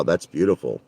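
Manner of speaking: ordinary delivery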